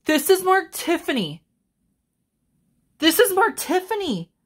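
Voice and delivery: very high, stunned exclamation; high-pitched real disbelief at a lucky find; astonished. Real, not acted. Unboxer discovers a Tiffany piece, astonished.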